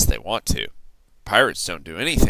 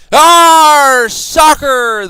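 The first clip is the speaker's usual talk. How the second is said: much higher, loud chorus; crew yell; 12 dB louder, much slower than usual. A narrator's reading. Pirate crew cheering soccer, loud high yell. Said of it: "the crew yelled".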